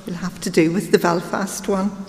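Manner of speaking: ordinary delivery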